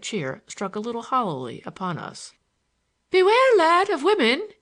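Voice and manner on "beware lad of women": much higher and louder pleading warning; a higher, louder Irish friend's earnest warning; urging Larry. A narrator's reading. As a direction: urge him, voice thrown high and loud. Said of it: "he importuned me".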